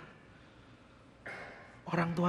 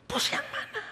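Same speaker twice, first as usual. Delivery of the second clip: much higher, insistent voice of the boss; pressing boss. Retold or imitated. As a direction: demand an answer, pushing harder. Boss presses him again. (bos bertanya dengan nada tinggi mendesak)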